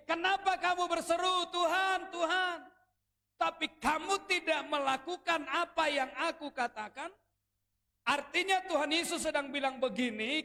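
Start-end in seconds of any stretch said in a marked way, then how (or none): 0.1-7.1 higher and louder, ringing out Jesus' rebuke; a booming rebuke from the pulpit; forceful preaching. Acted. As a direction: proclaim it like Jesus calling out the crowd. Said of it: "Yesus bilang begini".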